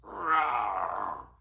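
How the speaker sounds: low, loud mock roar for a toddler; playful dinosaur roar. Parent roars at the toddler like a playful dinosaur.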